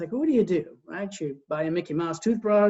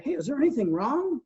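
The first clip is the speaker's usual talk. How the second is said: wife asking gently; 7 semitones higher than usual. Retold or imitated. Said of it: "my wife would probably come to me and say".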